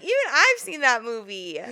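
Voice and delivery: hammed-up jab at her boyfriend; mock ex-girlfriend. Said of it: "she's like".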